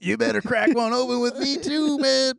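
high, hyped bro doctor; frat bro. Retold or imitated. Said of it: "imagine if he started the call like this".

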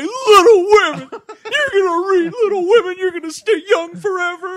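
put-on very high, wailing drunk-dad sob; mock crying. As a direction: wail it as a hammed-up tearful tantrum. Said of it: "He's, like, crying".

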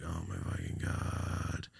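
slow, hushed shock; quiet dismay. Retold or imitated. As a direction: softly stretch it out, stunned. Reacting again to cancer news.

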